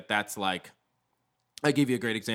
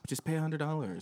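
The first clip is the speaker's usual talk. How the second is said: Tom Cruise; 4 semitones higher, 5 dB quieter than usual. Retold or imitated. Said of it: "he's like".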